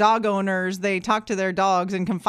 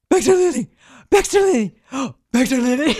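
excited doggy voice; 3 dB louder than usual. Retold or imitated. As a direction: call the dogs like you just got home. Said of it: "I'll be like".

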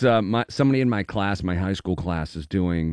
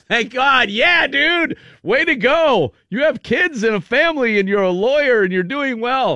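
high, loud cheerful bro voice; mock bro congrats; 14 semitones higher, 4 dB louder than usual. Imitating classmates cheering on the bullied kid, high and loud. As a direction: pitch it up like a hearty old classmate. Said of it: "everybody ... is like".

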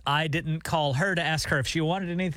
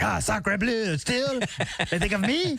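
put-on higher, hammy French accent; Jean-Luc French accent; 5 semitones higher, slower than usual. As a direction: play a dreamy French waiter with a French accent. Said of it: "Jean-Luc's over there in France hearing that commercial like".

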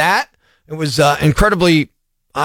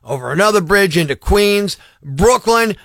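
usual speaking voice

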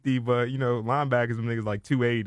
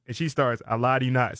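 usual speaking voice

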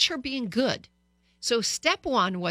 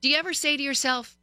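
ordinary talk, nothing marked

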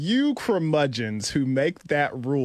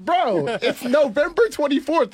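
mock incredulous kid; 10 semitones higher, 4 dB louder than usual. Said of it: "we look at him and be like".

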